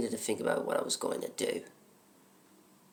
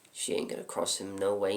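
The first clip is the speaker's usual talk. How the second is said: ordinary talk, nothing marked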